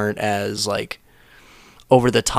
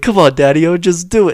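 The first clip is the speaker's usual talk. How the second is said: lightly voiced, higher, louder coaxing; peer-pressuring kid; 8 semitones higher, 7 dB louder than usual. Kid pushing Hitler to smoke weed, mock coaxing. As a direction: egg him on like a sketch kid. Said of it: "some kid pulls him to the side".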